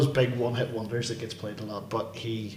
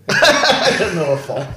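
laughing echo; 5 semitones higher, 7 dB louder, slower than usual. Real, not acted. Echoing through laughter.

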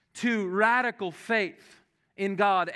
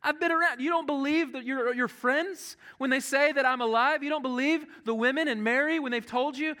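a much higher, impatient mock Jesus voice; exasperated scolding; 8 semitones higher than usual. Jesus scolding doubting Thomas, exasperated and high. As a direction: play Jesus fed up and nagging Thomas. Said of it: "Jesus doesn't go".

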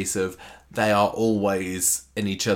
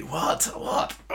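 reluctant groom; 9 semitones higher, 4 dB quieter, slower than usual. Acted. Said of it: "he doesn't seem as into it".

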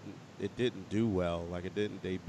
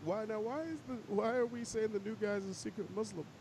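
incredulous pitch rise; 11 semitones higher than usual. Real, not acted. Incredulous challenge to friend.

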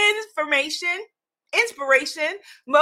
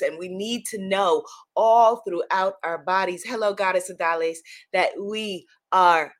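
low soothing guidance; 7 semitones lower than usual. Acted. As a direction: settle them into their bodies. Grounding body affirmation.

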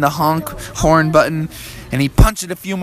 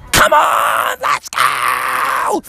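mock furious mom shout; strained, hammed-up angry yell; so mad; much slower than usual. Mom yells at the car that won't start, mock furious. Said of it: "Froggy's mom was getting so mad".

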